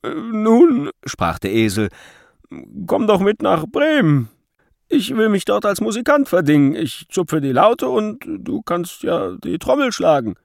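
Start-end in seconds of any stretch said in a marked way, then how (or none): 0.0-0.9 higher, louder cartoon donkey; cheerful donkey voice. A narrator's reading. Donkey character voice. 2.9-10.4 higher, louder cartoon donkey; cheerful donkey voice. A narrator's reading. Donkey character voice.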